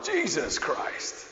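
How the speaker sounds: high, drawn-out showman announcement; talent-show announcer. Retold or imitated. Guessing how heaven's voice sounded, mock talent-show announcer. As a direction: stretch the name out like a TV show introduction. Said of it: "Or the Britain's Got Talent".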